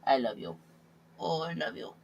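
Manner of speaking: soft, tender coo. Real, not acted. Holding his cat, soft tender coo. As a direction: whisper love to the cat.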